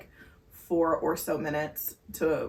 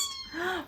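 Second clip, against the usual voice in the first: sharp high gasp; 8 dB quieter than usual. Real, not acted.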